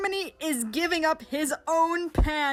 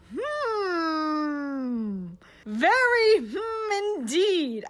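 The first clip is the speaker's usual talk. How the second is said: suspicious; 4 semitones higher, much slower than usual. Real, not acted. Suspicious musing about Russia.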